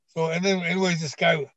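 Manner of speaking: ordinary talk, nothing marked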